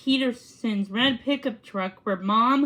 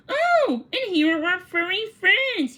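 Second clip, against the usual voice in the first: much higher, bright exclaim; delighted greeting; 7 semitones higher, 3 dB louder than usual. A narrator's reading. Mister Baker welcoming the dogs up the walk, delighted. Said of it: "he smiled".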